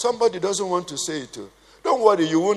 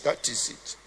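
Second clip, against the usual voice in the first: whispered aside; 4 semitones lower, 10 dB quieter than usual. Real, not acted.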